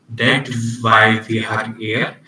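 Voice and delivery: ordinary talk, nothing marked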